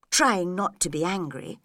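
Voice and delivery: usual speaking voice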